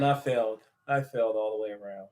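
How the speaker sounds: softly conceding defeat; mock defeat. Real, not acted. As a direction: concede the loss quietly. Admits a wrong guess.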